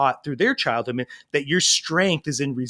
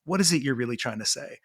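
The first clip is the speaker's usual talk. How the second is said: lowered, softer voice; gentle inquiry; 4 semitones lower, 5 dB quieter than usual. Retold or imitated. Dad choosing connection over anger, soft and inviting. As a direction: invite a child to open up, quietly. Gentle alternative to angry reaction.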